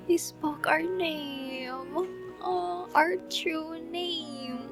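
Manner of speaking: high, slow, drawn-out awe; moved cooing. Real, not acted. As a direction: coo it slowly, touched, high in the voice. Moved by hearing the name.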